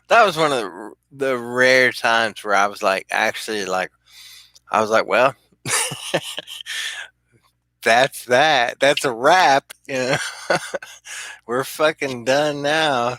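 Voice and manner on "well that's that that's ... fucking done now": laughing. Retold or imitated. Laughing at being caught.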